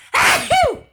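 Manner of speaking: sudden loud sneeze, pitched high; big real sneeze. Real, not acted. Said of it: "I think I've sneezed".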